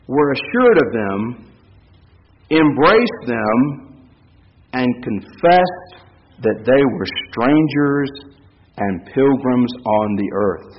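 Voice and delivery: slow solemn reading. Acted. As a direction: linger on each phrase of the verse. Slow reading of Hebrews verse.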